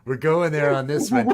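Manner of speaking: loud hyped-up yelling, laughing; excited shouting. Acted. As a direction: hype it up, almost shouting. Excited alien bit.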